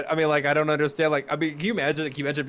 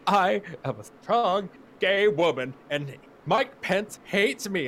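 higher, slow mocking grandstand; slow, higher mock of a self-important actress; 7 semitones higher, slower than usual. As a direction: drag it out like a pompous speech. Mocking Ellen Page's speech.

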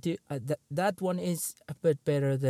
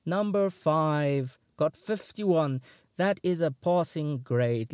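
ordinary talk

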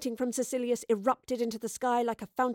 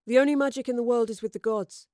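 insistent; 2 semitones higher, 5 dB louder than usual. A narrator's reading. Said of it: "insisted Gaius".

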